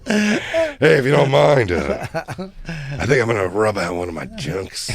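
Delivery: laughing through a creepy request. Retold or imitated. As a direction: crack up while voicing it. Imitating creepy neighbour.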